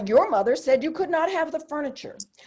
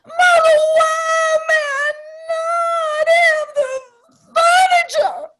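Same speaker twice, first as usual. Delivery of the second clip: much higher, loud and slow, mock crying in a Southern accent; hammed-up Southern sob over the furniture; Southern accent, pleading; 14 semitones higher, 5 dB louder, slower than usual. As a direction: beg through fake tears, Southern drawn out. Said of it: "I tried to say it nicely".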